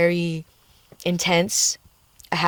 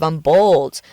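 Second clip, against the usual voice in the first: normal talking voice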